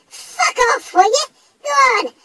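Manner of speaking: high, loud bellow; all-out angry yell; furious shout. Acted. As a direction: shout the ghost off the property. Chasing the ghost away.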